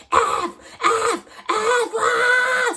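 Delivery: imitating a scream. Man on the stalled subway imitated screaming curses. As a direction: mimic the frantic man losing his mind.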